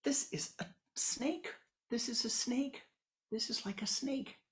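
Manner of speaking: high hushed wonder at the snake; a whispered, wondering blind man; blind man's whisper. Acted. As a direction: breathe it out in awe. Blind man feeling the trunk.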